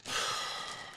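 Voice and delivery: soft, breathy heavy sigh; defeated sigh. Real, not acted. Fan sighing heavily over the loss. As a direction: let it all out.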